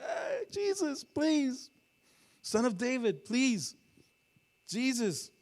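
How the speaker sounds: very high, loud pleading cry; desperate yelling. Acted. Canaanite woman yelling after Jesus as he walks by, desperate yell. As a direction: shriek it out, begging to be heard. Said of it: "she was yelling".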